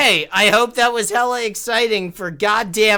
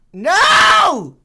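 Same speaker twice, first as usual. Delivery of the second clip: anguished yell; 10 semitones higher, 8 dB louder than usual. Real, not acted. Losing the game.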